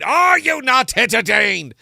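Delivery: slow, high, full-throated showman's roar; theatrical bellow. Acted. Entertainer challenging his audience after hoping they're entertained, theatrical bellow. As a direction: bellow it out grandly to the whole arena.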